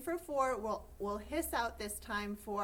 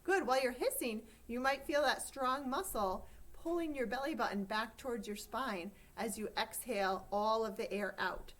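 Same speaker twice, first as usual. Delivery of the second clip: ordinary talk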